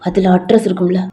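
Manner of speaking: low, firm man scolding her; stern boyfriend voice. Acted.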